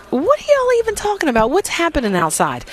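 high-pitched, disbelieving outburst; incredulous. Real, not acted. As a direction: question it in exaggerated disbelief, voice shooting up. Reacting to shocking heat forecast.

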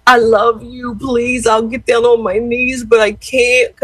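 lightly wailed plea; imitated tearful pleading. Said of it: "I mean, he cried".

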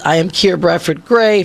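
ordinary talk, nothing marked

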